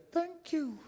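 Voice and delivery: tiny high creature voice; an unseen little being asking sweetly for honey; very high small voice, slow on the greeting. A narrator's reading. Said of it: "And the voice said".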